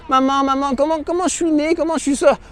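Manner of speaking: a hint of a child asking his mother; little child asking. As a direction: ask like a small curious child. Child asking mother how born.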